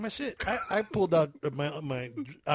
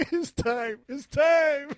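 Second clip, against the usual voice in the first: high, loud call through laughter; mock doomsday cry; 9 semitones higher, 4 dB louder, much slower than usual. Friend teases the other about giving up in earthquake, laughing. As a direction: crow it, cracking up.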